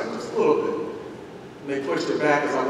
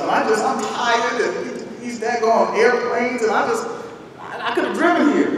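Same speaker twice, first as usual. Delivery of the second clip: high, slowed exasperated complaint; 7 semitones higher than usual. Retold or imitated. Husband venting to wife, high exasperated whine. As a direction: let it rise in weary frustration.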